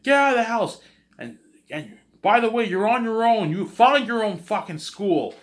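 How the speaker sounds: very high, loud furious yell; father yelling. Retold or imitated. Father kicking the son out, high loud yell. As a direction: throw the son out with a roar. And he's like.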